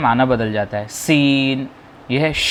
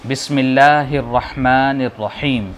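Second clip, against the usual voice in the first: slow, measured recitation; slow Quranic recitation; slower than usual. Shown as a demonstration. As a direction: recite it slowly and reverently. Teacher recites Bismillah slowly.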